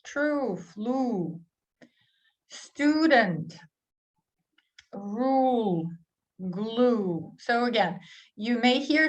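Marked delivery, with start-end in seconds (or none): 0.0-7.3 "True", "flew", "Student", "rule", "glue" with long oo; oo sound. Shown as a demonstration. Teacher listing oo words for learners. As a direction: hold a rounded oo in each word. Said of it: "this one is oo sound".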